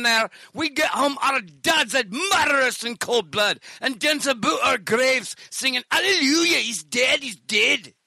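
high, hammed-up Scottish lament; Scottish accent. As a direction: play a Scot boasting of childhood misery. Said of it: "it sucks to be Scottish".

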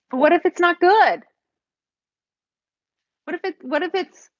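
worried protest. Real, not acted. Painter balking at scraping her work, worried protest. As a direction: blurt out the worry, pitch jumping up.

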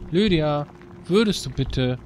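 usual speaking voice

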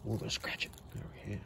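soft whisper to a pet. Real, not acted. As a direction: hush it to the cat. Scratching the cat softly.

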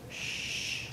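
soft, voiceless shushing sound; hushing. Shown as a demonstration. Leader asking children if they've been hushed, soft shush. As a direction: demonstrate a gentle "shh". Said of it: "Has anyone ever told you".

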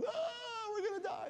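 high crying wail of panicking little fish; freaking out. Acted. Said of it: "They're freaking out".

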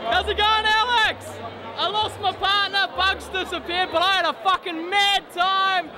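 excited. Real, not acted. Excited concussed fan greets camera.

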